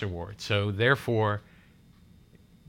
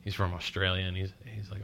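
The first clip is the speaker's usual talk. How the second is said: usual speaking voice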